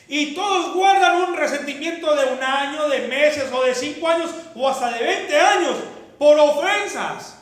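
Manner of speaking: loud, building sermon climax; full-force preaching. Acted. Pastor thundering at his church, preaching loud. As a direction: preach it hard to the congregation.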